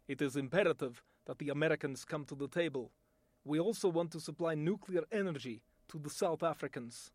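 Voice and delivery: low, fast, grave Russian accent; a Russian official making demands of the Americans. Shown as a demonstration. A Russian statesman setting terms, in a Russian accent. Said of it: "my voice talent demonstration".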